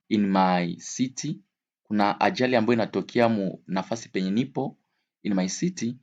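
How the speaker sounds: ordinary talk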